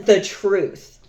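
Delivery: ordinary talk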